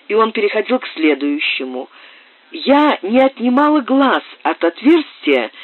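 ordinary delivery

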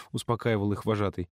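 ordinary delivery, nothing marked

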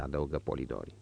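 usual speaking voice

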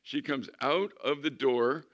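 normal talking voice

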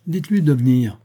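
father ordering his son in; stern command. A narrator's reading. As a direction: summon him curtly.

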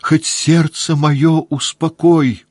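high, loud, slow begging; pleading cry. A narrator's reading. Woman begs Pierre to calm her heart, crying out loud. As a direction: implore him desperately. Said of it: "закричала она".

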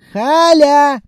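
high, drawn-out holler; calling out loudly. A narrator's reading. Clerk calls the absent Khalya after waiting, loud impatient yell. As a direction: yell the name across the office.

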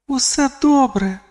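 put-on very high, gentle girlish voice; reassuring girl. A narrator's reading. Girl calming a panicking friend by thought, reassuring.

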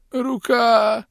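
high, pained cry of the word; moan of pain. Acted. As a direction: groan it out in pain. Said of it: "простонал". (стон от боли)

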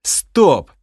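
loud sharp stop; barked stop. Acted. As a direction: cut him off hard. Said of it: "рявкнул я". (Росгард обрывает Бома, громко)

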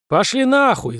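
резкий громкий выкрик вверх; выкрикни в окно во весь голос; вопль. A narrator's reading. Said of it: "завопила Шиазан". (громкий вопль)

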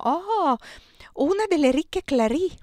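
high, loud exclamation; exclaiming. A narrator's reading. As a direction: blurt it out, amazed. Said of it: "esclamò". (esclamazione acuta e sorpresa)

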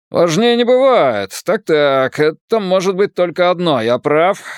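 mock «Важнее не бывает» back in an Irish accent. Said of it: "Ирландский акцент работал на всю катушку".

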